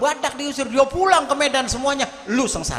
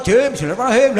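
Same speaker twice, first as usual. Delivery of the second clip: louder mock Madurese-accented bismillah; 6 dB louder, much faster than usual. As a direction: imitate a Madurese reciter. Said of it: "sebelum saya taruh".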